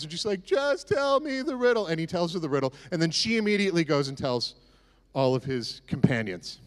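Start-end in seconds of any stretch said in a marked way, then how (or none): 0.4-1.9 mock wailing plea. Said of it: "she weeps and she cries and she wails".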